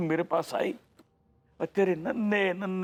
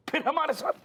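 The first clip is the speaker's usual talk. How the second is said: high-pitched, incredulous repeat; indignant echo; 10 semitones higher, faster than usual. Acted. Father challenging the objector's complaint, high indignant echo. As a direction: throw the words back much higher, outraged.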